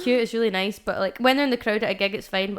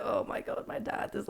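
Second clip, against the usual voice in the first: panicked whisper; 7 dB quieter than usual. Retold or imitated. Spotting dad at the gig.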